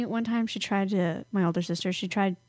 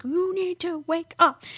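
slow, higher, insistent; insistent wake-up call; 7 semitones higher than usual. Retold or imitated. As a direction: drag out wake up firmly. Retelling waking friend.

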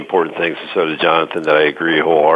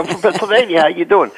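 higher-pitched, animated retold hello; excited mock greeting; 11 semitones higher than usual. American greets a fellow East Coaster in France, mock excited. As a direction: playfully exclaim it, voice jumping up.

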